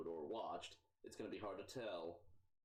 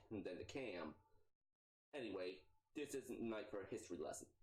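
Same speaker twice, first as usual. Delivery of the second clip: normal talking voice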